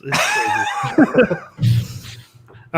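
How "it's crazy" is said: high, stretched-out laughing shout. Real, not acted. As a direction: burst out laughing mid-word. Laughing at the actor's face.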